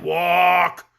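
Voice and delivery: short gruff growl of a goat. Acted. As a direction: bark the order curtly. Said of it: "growled Bilbil".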